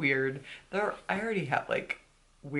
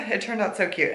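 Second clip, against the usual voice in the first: usual speaking voice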